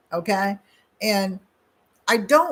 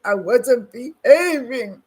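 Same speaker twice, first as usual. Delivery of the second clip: put-on very slow, louder little-boy confession; boy admitting to the stranger he misbehaved, slow sheepish child voice; 3 semitones higher, 6 dB louder, much slower than usual. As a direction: drag it out like a guilty kid. Child answering the woman.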